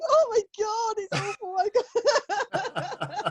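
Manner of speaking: hammed-up fake sobbing, high and slow; mock crying. Mocking herself over the trolls, hammed-up fake crying. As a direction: pretend to weep with a laugh breaking through. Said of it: "She says, crying".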